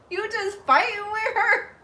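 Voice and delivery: high, cracking up mid-quote; laughing retelling. Retold or imitated. Partner recalling seeing her fight a girl, laughing. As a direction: squeal it through helpless laughter.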